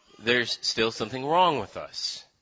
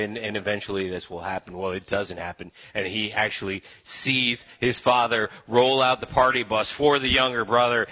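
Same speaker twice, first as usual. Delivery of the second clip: usual speaking voice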